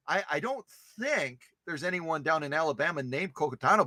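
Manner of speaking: usual speaking voice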